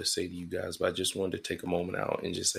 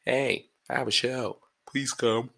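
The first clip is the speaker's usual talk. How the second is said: higher, eager begging voice; mock pleading invite; 5 semitones higher, 5 dB louder, slower than usual. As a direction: plead lightly for them to come.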